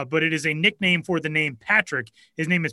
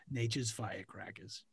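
much softer, low Boston mumble; Boston accent; 6 semitones lower, 19 dB quieter than usual. Pretend. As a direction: throw away a Boston ad line. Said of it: "your cousin from Boston".

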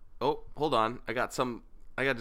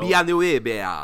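Villeneuve said slowly with a Spanish accent, a bit louder; Spanish-sounding accent; 3 semitones higher, 5 dB louder, slower than usual. Shown as a demonstration. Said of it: "still saying it with a Spanish accent".

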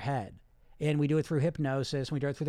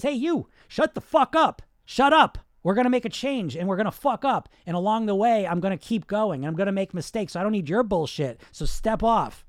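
forceful shout, higher and louder; defiant new voice; 3 semitones higher, 7 dB louder than usual. Acted. New voice standing up to the inner critic, defiant shout. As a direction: shout down a bully with full conviction. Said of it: "I got this new voice that comes up".

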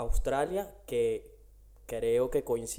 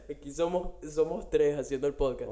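laughing; 4 semitones higher than usual. Real, not acted. Laughing at the interruption.

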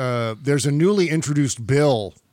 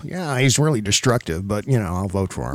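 lightly mocked grudging politician, sinking lower; passive-aggressive politician; 6 semitones lower than usual. Host mocks Barr's grudging loyalty, lightly passive-aggressive. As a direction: shrug it off, voice dropping away. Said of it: "that passive-aggressive voice".

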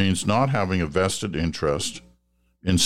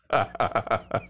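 laugh; 5 semitones higher, 3 dB quieter than usual. Real, not acted. Laughing at the salt joke.

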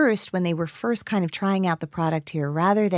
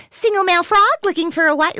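much higher and louder cartoon frog; pitched-up silly frog dub line; 11 semitones higher, 7 dB louder, faster than usual. Shown as a demonstration. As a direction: squeak it out like a dubbed animated frog. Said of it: "like, the frog".

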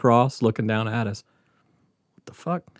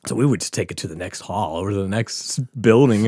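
usual speaking voice

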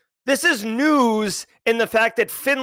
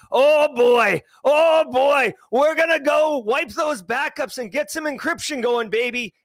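gleeful; 6 semitones higher than usual. Retold or imitated. Voicing gleeful released ransomware criminal.

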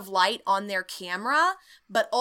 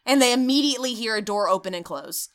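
ordinary delivery

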